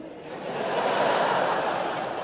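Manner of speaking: short unvoiced laugh; breathy laugh. Real, not acted. A real breathy laugh at the sisters' question.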